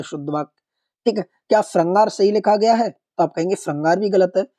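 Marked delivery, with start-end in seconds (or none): none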